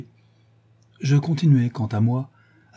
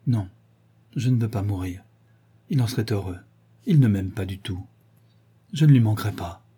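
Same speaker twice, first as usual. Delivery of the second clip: ordinary delivery, nothing marked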